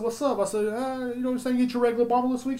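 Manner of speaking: high, bright retold hello; lightly hammed-up cheerful clerk; cheery greeting.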